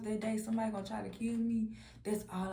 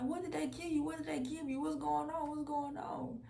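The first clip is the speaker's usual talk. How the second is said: high, panicked questions without full volume; mock scream; 7 semitones higher than usual. Mama screaming at her drugged child, mild mock scream. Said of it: "she kept screaming".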